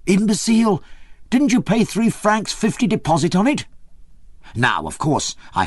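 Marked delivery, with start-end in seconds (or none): 0.1-3.6 put-on excited peasant girl. A narrator's reading. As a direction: crow at him for forgetting. Maria excited, scolding.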